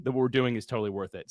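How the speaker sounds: ordinary talk, nothing marked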